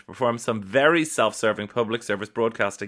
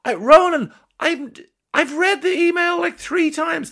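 exasperated outburst; 5 dB louder than usual. Real, not acted. Exasperated reaction to threatening email.

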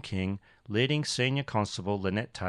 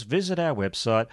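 ordinary talk, nothing marked

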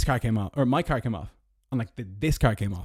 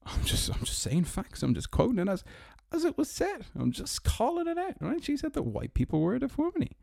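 much higher, falsetto-ish faux-innocent voice; mock innocent sarcasm; 12 semitones higher, 5 dB quieter than usual. Mocking the BLM founder to viewers, high sarcastic voice. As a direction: play dumb, sing-song and sarcastic.

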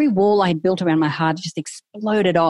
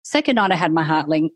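ordinary delivery

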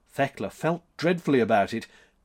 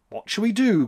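high, raised plea; crying out; 8 semitones higher, 4 dB louder than usual. A narrator's reading. July asks Max for his terms, crying out. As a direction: call out the question high. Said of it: "cried July".

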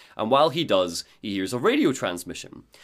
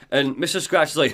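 ordinary delivery

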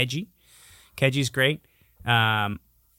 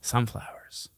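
hushed reveal; 4 semitones lower, 9 dB quieter, slower than usual. Acted. Big reveal after teasing buildup.

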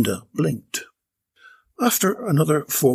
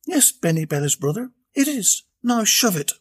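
higher, tart put-down; Belinda; 9 semitones higher than usual. A narrator's reading. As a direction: fire back with a sneer. Belinda retorts to Benny.

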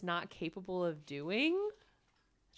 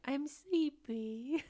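put-on high, slow, drowsy little boy voice; imitating a sleepy toddler; sleepy kid; 7 semitones higher, much slower than usual. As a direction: whine it out like a tired child. Kid losing interest in show.

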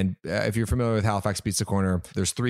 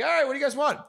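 generous host; much faster than usual. Retold or imitated. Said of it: "I'm like".

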